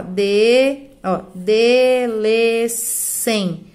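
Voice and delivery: very slow, long held syllables; syllable-by-syllable scansion. Shown as a demonstration. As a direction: show how the syllables link. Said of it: "Aqui que vai juntar".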